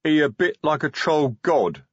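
reluctant troll mumble; a hint of a guilty troll; muttering troll. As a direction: drag the words out grudgingly. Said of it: "Detritus muttered".

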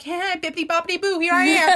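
put-on little girl. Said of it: "I walk in like this little girl".

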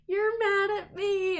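put-on high, slow drunken whine; drunk friend whining. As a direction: drag it out like a tipsy friend accusing. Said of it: "she was like".

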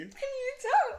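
taunt. Pretend. Finishing the husband's mocking quote.

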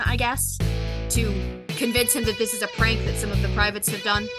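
ordinary talk

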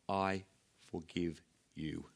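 slow, soft, low words of forgiveness; solemn forgiveness. Acted. Paul forgiving Mark after their sharp argument, quiet and slow. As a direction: let each word fall quietly, with space between.

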